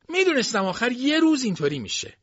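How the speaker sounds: very high-pitched voice; know-it-all boast. A narrator's reading. Luster shows Dilsey he understands everything, boastful. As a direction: pitch it way up, showing off.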